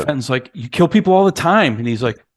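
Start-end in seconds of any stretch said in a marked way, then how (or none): 0.5-1.8 higher-pitched mock accusation; raised, incredulous retort; accusing. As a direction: imitate a son challenging his dad. Retold son accusing his father.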